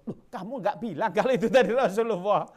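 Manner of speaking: high, loud, aghast; shocked dismay. Acted. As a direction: realize in horror who it was. Woman shocked to learn identity.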